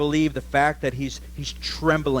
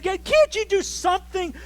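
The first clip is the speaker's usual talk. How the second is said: crying out. Retold or imitated. Grown son crying out to his father for help, mock wail. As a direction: cry out to your father for help. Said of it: "weeping uncontrollably and crying out".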